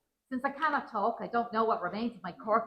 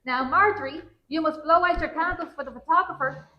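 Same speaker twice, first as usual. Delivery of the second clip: put-on louder, higher bellow into an old woman's ear; 6 semitones higher, 6 dB louder than usual. A narrator's reading. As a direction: raise pitch and volume as if addressing someone hard of hearing. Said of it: "to bellow into my good ear".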